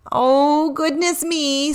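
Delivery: sheepish reindeer, a touch embarrassed; mildly embarrassed Rudy; lightly voiced Rudy. A narrator's reading. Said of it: "said Rudy".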